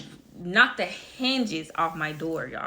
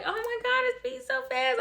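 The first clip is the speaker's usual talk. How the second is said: high-pitched re-enacted amazement; astonished. Mother-to-be hears the baby's heartbeat, astonished. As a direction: squeak it out in wonder, much higher. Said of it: "I was just so astonished".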